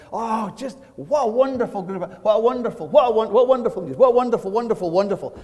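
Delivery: high-pitched, tumbling, repeated raptures; gushing rave. Retold or imitated. Churchgoer raving at the bank about Sunday, hammed-up gushing. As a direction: pile up the praise in hammed-up delight.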